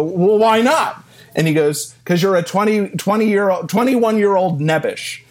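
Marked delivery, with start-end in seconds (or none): 0.0-1.0 high, louder, drawn-out clueless question; naive young idiot. Retold or imitated. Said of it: "as a twenty-year-old idiot, I go". 2.1-5.2 imitating a mentor's teasing, pitched higher; loving, funny jab. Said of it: "He said it very lovingly and funny".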